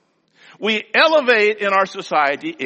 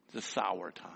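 whisper; 10 semitones lower, 14 dB quieter than usual. Real, not acted. Preacher repeats line softly.

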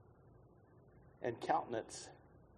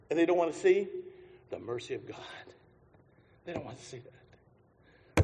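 ordinary talk

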